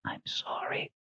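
faint, tearful whisper; barely audible whisper. Acted. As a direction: breathe it out, barely there. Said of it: "she whispered, barely audible".

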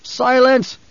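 high, commanding cry; roared command. Acted. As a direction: roar it out to silence her. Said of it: "Paul roared".

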